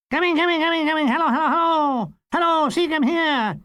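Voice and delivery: high, hurried comic chatter; high eager Goon voice. Retold or imitated. Seacombe hurries to phone.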